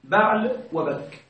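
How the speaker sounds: بعل وبك said slowly as two separate words; teacher separating بعل and بك slowly; splitting the word. Shown as a demonstration. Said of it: "هذه الكلمة أصلًا كلمتان".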